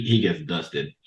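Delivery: normal talking voice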